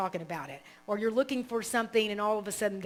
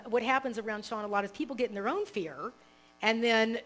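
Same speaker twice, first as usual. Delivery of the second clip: usual speaking voice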